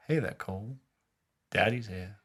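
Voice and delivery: soft, unhurried creepy greeting; creepy devil. Retold or imitated. Devil greets the fatherless sergeant, softly creepy. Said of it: "he's been really fucking creepy".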